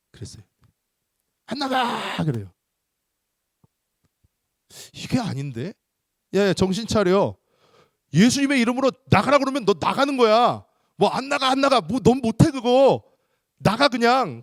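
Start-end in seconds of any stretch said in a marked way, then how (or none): 1.5-2.2 high, stretched mock cry; defiant demon. Demon refuses the exorcist once more, high defiant cry. 6.3-14.4 high, loud exasperated telling-off; indignant scolding. Retold or imitated. Exorcist scolds a disobedient demon, high and loud.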